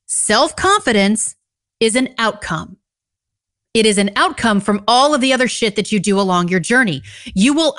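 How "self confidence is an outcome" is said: slow, every word spelled out; slow and over enunciating. Shown as a demonstration. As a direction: lay each word down deliberately. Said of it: "let me say that again slowly".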